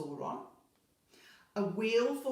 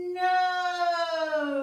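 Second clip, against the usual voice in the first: long, high, loud cry of no; drawn-out child's cry of despair; horrified wail; 10 semitones higher, 13 dB louder than usual. Acted. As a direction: wail it out on one held note. Miranda cries out in horror.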